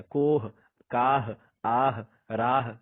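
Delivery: "کوہ، کاہ، آہ، راہ" slow, each final h clearly sounded. Shown as a demonstration. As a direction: slowly, breathe out the final h of each word. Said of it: "ان تمام میں ہم ہا کو پڑھ رہے ہیں".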